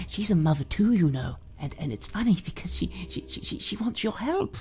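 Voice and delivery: put-on high, wheedling drunk mother with sudden whispers; creepy doting mother. Mother wheedling her grown son Joshy, creepy doting voice. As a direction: coax your son sweetly, menace underneath.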